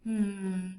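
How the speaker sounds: ordinary talk, nothing marked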